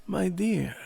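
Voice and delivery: put-on high, ringing "My dear" from an old aunt; old aunt's welcoming call; rich old lady greeting. A narrator's reading. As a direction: greet her grandly, slightly foreign. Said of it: "her voice was rich and rounded".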